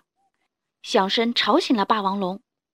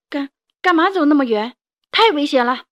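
louder worried scolding father; angry shout; 4 semitones higher, 8 dB louder than usual. A narrator's reading. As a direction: scold the child for going so far. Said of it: "霸王龙生气地大声叫着". (霸王龙生气地大声吼)

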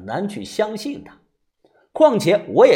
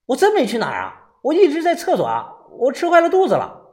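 high, hurried self-defense; flustered, urgent denial; anxious protest; 9 semitones higher, faster than usual. A narrator's reading. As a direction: rush out your excuse, pitch climbing. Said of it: "豆芽仔着急地".

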